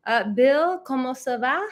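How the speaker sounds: reading a viewer's French hello; French greeting. Retold or imitated. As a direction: sing out bonjour with a French lilt. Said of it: "Bill says".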